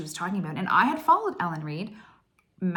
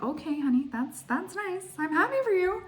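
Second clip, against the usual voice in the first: a lightly voiced husband, higher, humouring her; mock indifferent husband; 10 semitones higher than usual. Husband humouring his wife's fangirl news, lightly voiced mock calm. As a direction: play the husband patiently humouring his excited wife.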